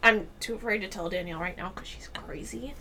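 whispered mock-fearful aside; hushed confiding. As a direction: drop to a whisper as if she might hear. Confides fear of Danielle.

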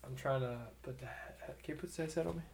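unvoiced, soft whisper aside. Real, not acted. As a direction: whisper off mic to your partner. Aside to partner off mic.

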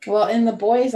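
ordinary talk, nothing marked

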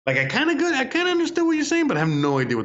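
squeaky, fast bewildered voice; high-pitched mock confusion. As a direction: go high and flustered. Mocking own confusion.

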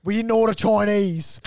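loud, slow, declaring dad impersonation; Wog dad from Super Wog. Acted. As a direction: proclaim it loudly and slowly, in character. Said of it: "like the Wog dad in Super Wog".

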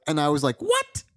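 what jumps way up in a squeak; mock surprised what. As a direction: ask what in shocked disbelief. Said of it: "we're always just like".

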